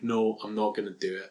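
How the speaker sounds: low, soft mumbled refusal; mock sullen refusal. Podcaster voicing Brad Pitt's reluctant vampire, mock sullen refusal. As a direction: imitate a glum refusal, dropped low.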